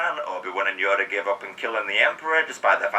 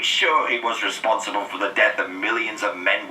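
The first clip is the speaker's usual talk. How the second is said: usual speaking voice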